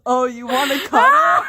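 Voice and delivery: can't hold back the laugh while quoting him; laughing through quote. Retold or imitated. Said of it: "and he goes".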